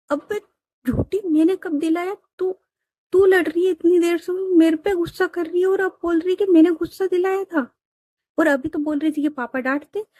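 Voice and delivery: high, pleading little sister in a spat; whiny protesting sister. Acted. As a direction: push the pitch up and protest. Younger sister protests in quarrel. (ऊँची आवाज़ में शिकायत करती छोटी बहन)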